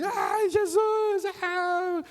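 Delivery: very high, loud drawn-out cry; mock desperate shriek; demonstrated scream. Shown as a demonstration. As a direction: wail it out desperately, stretching each word.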